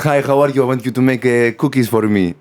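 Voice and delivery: put-on light Greek-accented caricature; Mister Panos impression. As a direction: do the comedian's Greek character in a Greek accent. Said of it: "he goes".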